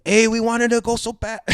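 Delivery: put-on high, loud whiny Mexican-accent protest; mock Mexican accent. His people protesting about Universal, mock Mexican accent. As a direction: complain in a hammy Mexican accent. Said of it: "mi gente are gonna go".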